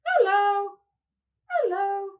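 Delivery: teacher voices the puppet greeting the class. Pretend. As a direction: make the dog puppet say hello. Puppet speaks.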